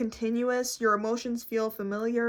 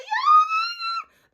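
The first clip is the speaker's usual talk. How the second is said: very high, slow joyful wail; hammed-up overjoyed shriek; mock happy crying; 7 dB louder, slower than usual. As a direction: fake a loud, tearful celebration. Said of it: "start crying happy tears".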